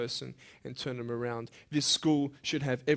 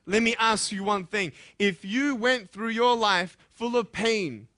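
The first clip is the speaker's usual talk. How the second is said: impassioned preaching; 10 semitones higher, 7 dB louder than usual. Acted. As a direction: preach it, pleading with the students. Preaching at full force.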